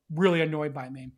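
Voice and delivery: ordinary talk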